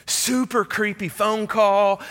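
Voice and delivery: high, slow drawn-out creepiness; hammed-up spooky. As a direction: stretch it out to spook your friends. Telling friends about the creepy call.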